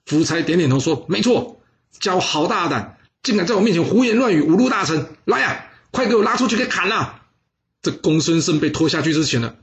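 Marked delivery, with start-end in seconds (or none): 1.1-7.2 higher, fast angry royal command; king's furious order. Acted. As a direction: bark the death sentence. King orders execution in rage. (暴怒下令)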